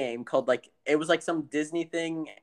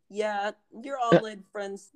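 slow, higher-pitched parody of a parent; mock parent voice; 5 semitones higher, slower than usual. Parent warning about online friends, mocking parent voice. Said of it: "your parents, when they're like".

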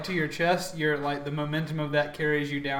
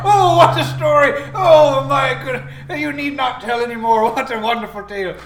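big, high, loud pirate guffawing through the words; laughing pirate; 7 semitones higher, 10 dB louder than usual. Acted. Pirate hearing a sailor's tall tale, hammed-up hearty laughter. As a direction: roar with theatrical delight like a pirate. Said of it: "so the pirates say".

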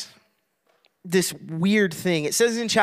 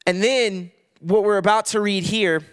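ordinary delivery

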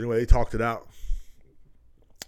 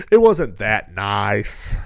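slow, loud, drawn-out laughing repeat; 11 dB louder, much slower than usual. Shown as a demonstration. Laughing as he corrects himself about the neighborhood. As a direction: stretch it out through a laugh.

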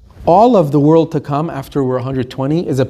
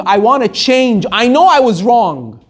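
raised, drawn-out cry of regret; screaming in regret; 6 semitones higher than usual. Acted. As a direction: cry out as one condemned, stretching the words. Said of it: "They're screaming".

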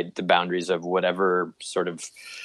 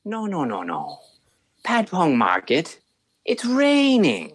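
maitre d' voice; slower than usual. Retold or imitated. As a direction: stretch it out like a smooth liar. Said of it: "the maitre d' is going".

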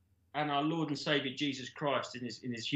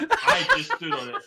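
high voice breaking into laughter; laughing; 8 dB louder than usual. Retold or imitated. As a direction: squeak the line out through laughs. Captor voiced, laughing through it.

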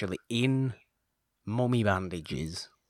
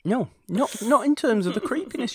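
excited; 7 dB louder than usual. Real, not acted. Excited agreement about creepiness.

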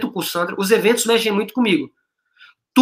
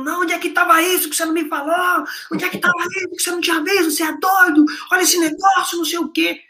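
much higher, near-shouted disbelief, fast at times; amazed outburst; 10 semitones higher than usual. Retold or imitated. Wife startled by her award, a high near-shout of disbelief. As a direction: act out his wife's thrilled shock.

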